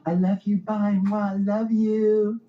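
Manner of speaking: high, loud, drawn-out kissy goodbye; gushing goodbye. Retold or imitated. Wife gushing goodbye to her husband, high and kissy. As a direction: gush it with a kiss noise. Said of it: "she's all like".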